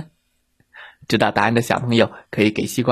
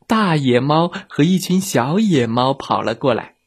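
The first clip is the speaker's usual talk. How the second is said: usual speaking voice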